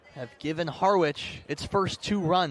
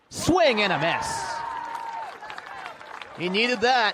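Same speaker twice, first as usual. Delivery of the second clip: loud, higher burst for the strikeout; excited call; 8 semitones higher, 4 dB louder than usual. Real, not acted. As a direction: punch out the big strikeout call. Strikeout ends the inning.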